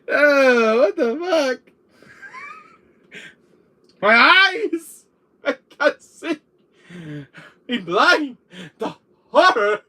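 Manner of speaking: high, loud melodramatic wailing; mock horror. Reacting to the girly impression, hammed-up horror. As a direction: wail as if blinded by the sight.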